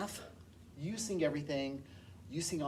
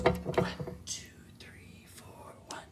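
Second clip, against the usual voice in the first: whispering the beat; whispered count; slower than usual. Shown as a demonstration. Teacher counting beats for finger tapping, whispered. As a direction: count under the breath in a whisper.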